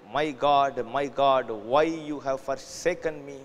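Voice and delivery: ordinary talk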